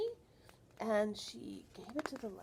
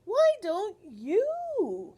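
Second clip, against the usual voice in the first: librarian's question; 8 semitones higher, 8 dB louder, much slower than usual. Acted. Librarian voiced in read-aloud.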